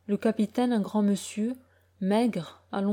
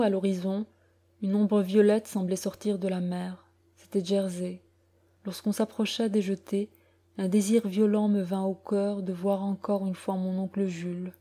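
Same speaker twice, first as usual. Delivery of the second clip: ordinary delivery, nothing marked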